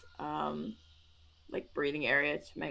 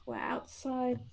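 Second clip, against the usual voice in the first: gentle, higher, careful voice; calm, spaced-out reassurance; grounding reassurance; 5 semitones higher than usual. Acted. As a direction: soothe a panicked patient. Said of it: "just attempting to ground him".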